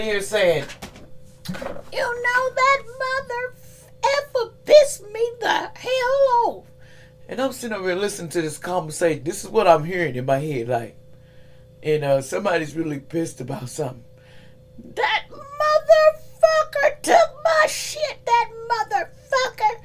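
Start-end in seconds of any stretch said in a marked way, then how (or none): 1.5-6.6 high, slow, furious put-on voice with a wail; a wailing angry spirit yelling; high mock-crying rant. Voicing an angry spirit message. 15.0-19.8 high, slow put-on voice, half crying; high mock-crying complaint; wailing angry voice. Voicing a pissed-off message.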